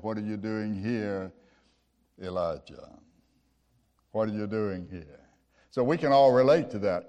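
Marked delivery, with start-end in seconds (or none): 0.0-5.2 low, soft, hushed voice of God; still small voice. Retold or imitated. God's still small voice questioning hiding Elijah, low and hushed. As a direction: lower and hush it, a still small voice.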